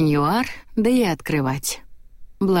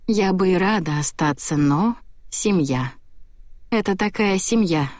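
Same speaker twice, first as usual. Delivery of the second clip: plain reading voice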